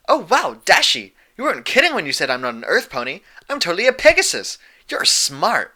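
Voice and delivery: high, loud and fast, bursting with glee; bubbly excited filly. Acted. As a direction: squeal it out like a giddy pony who just got praised. Pinkie's eager voice.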